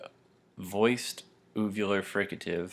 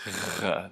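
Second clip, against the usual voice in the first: voiced uvular rasp. Shown as a demonstration. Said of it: "hopefully you guys can hear that in my voice".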